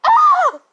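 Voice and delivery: very high, loud short scream; sudden piercing yelp, played for laughs; imitated scream. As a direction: let out a high mock shriek. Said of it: "and then you hear a scream".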